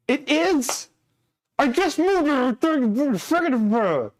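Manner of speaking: high-pitched disbelieving protest; exasperated outburst. Real, not acted. Just moved the game, protests to chat in high exasperation. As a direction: squeak out the injustice. Chat corrects him after moving games.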